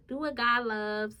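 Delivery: put-on Liberian accent. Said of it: "I try to do her accent".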